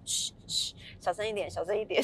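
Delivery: hushed, soft shush; shushing nurse. Retold or imitated. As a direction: quietly tell her to keep it down. 护理师叫她小声. (护理师压低声音嘘)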